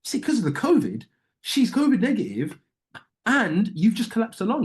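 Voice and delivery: high, scornful repeat of their excuse; sarcastic parroting, pitched high; incredulous mocking echo. Real, not acted. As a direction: throw their words back at them, disbelieving.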